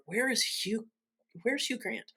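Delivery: mock dramatic plea. Mock quoting the film's line.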